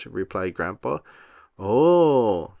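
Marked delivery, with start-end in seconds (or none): 1.6-2.6 excited gasp. A narrator's reading. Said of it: "gasped Peppa excitedly".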